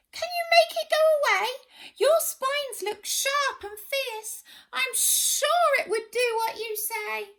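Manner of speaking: high small creature voice; high squeaky bush baby. Acted. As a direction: plead in a tiny squeaky voice.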